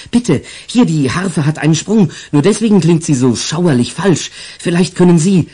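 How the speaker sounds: put-on high, eager little girl; pleading girl. Isabel quickly pleads with the old commissioner, high and eager. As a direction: urge him quickly like the child.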